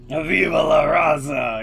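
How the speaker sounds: loud, high, drawn-out catchphrase shout; Eddie Guerrero. Acted. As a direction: belt it out slowly like a wrestler's battle cry. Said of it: "totally Eddie Guerrero".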